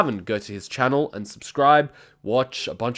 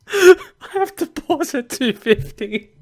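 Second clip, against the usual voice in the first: high, slow words breaking with laughter; laughing so hard he has to pause; 13 semitones higher, 5 dB louder, slower than usual. Real, not acted. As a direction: try to talk through the laughter. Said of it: "struggling to stop himself from laughing".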